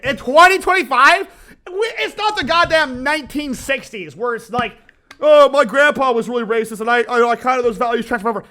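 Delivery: heated rant. Real, not acted. Heated rant about racists.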